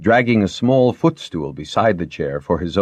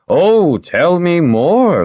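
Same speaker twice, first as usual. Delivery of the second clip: high, slow and louder amused invitation; drawn-out tease; 12 semitones higher, 7 dB louder, much slower than usual. Acted. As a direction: stretch it out teasingly, up high. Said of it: "Lightoll said".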